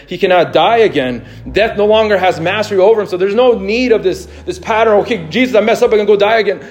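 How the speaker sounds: loud, high-pitched preacher's delivery; impassioned preaching. Real, not acted. Preaches at full force.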